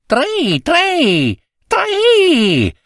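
very high chirping tri tri tri; cricket trill. Acted. Cricket answering the husband with a high trill.

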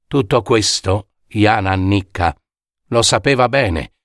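plain reading voice

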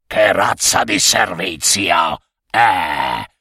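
low-pitched grumbler's voice; grumbling protester. A narrator's reading. Passenger complains about the bus service, low grumble. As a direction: grumble as one disgruntled passenger in the crowd. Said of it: "le proteste diventarono un coro tempestoso".

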